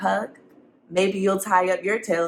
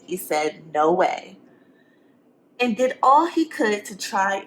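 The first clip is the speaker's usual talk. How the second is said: lively, sing-song storybook reading; reading to children; 3 semitones higher than usual. A narrator's reading. Teacher reading a picture book to the class. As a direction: read it to the kids with rhyme.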